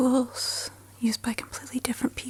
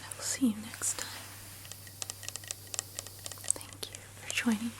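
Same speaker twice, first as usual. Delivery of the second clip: fading sleepy whisper, slowing down; soft spa whisper; 11 dB quieter than usual. Acted. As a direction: lull them to sleep in a hush. Bedtime sign-off whisper.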